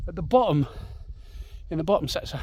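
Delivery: ordinary talk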